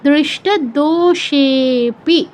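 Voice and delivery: "দোষেপি" slow, with ষে held longest; pluta pronunciation. Shown as a demonstration. Teacher repeating the model for students, দোষেপি slowly stretched. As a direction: drag out ষে in "দোষেপি" before a short pi. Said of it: "এরকম".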